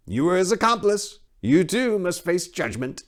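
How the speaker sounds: high, oily priest voice; a leering, smug high priest; sly high priest. Acted. High priest placating the king's men, high and oily.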